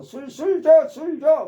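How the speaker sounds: high, loud, desperate begging for booze; craving drunk. Retold or imitated. Teacher imitating an alcoholic begging for drink, craving. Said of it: "막 이러면서 누구누구에게 말하는 거죠".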